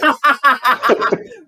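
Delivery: high, loud burst of laughter; real laugh. Real, not acted. Teacher laughs at student's "way more.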